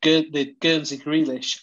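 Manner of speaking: normal talking voice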